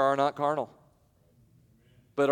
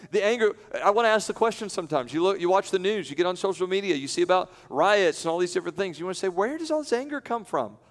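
preaching; 6 semitones higher than usual. Acted. Preacher rising on anger in the news.

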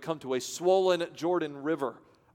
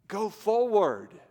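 slow, louder "Go forward" with a push on forward; firm command; 5 semitones higher, 4 dB louder, much slower than usual. Acted. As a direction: proclaim "Go forward" slowly and strongly. God's command said twice.

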